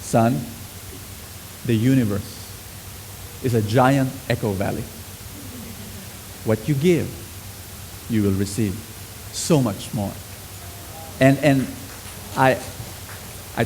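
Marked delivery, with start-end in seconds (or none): 0.0-10.1 lower, softer fatherly voice; soft, low father lecturing his small son; gentle lecture. Retold or imitated.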